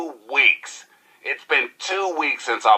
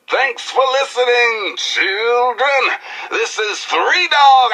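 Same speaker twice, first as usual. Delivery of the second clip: hyped DJ sign-off; 13 semitones higher than usual. Acted. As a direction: pump up the crowd with your own name. Radio DJ sign-off.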